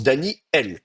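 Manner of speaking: "Daniel" as Dani-El, air cut before El; glottal stop. Shown as a demonstration. Teacher gives the normal pronunciation, glottal stop in "Daniel". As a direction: block the breath in "Daniel" between i and e. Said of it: "qui bloque le passage de l'air".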